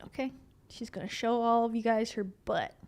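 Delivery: normal talking voice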